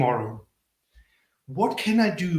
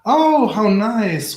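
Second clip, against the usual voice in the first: slow, louder mock delight; sarcastic; 6 semitones higher, 8 dB louder, slower than usual. Real, not acted. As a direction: drag out fake pleasure at the spammer. Host reacts to a spammer.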